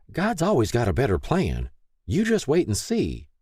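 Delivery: lightly voiced encouraging mom; encouraging mother. A narrator's reading. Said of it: "Mary encouraged".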